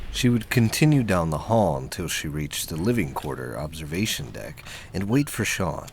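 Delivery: normal talking voice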